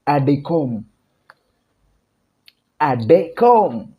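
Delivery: Adecom said as one run-together word; Pidgin pronunciation. Shown as a demonstration. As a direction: drill "Adecom" slowly and clearly. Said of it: "If you heard my pronunciation, I dey come".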